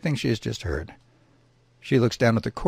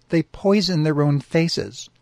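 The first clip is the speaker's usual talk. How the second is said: slow, weighty, louder reveal; dramatic reveal; 5 semitones higher, 4 dB louder, slower than usual. Acted. He-bear telling his mate the grim secret slowly. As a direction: lean in and drop the bombshell.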